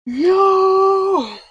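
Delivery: high cry of joy; excited shout. Real, not acted. Delivery driver shouting at a big order.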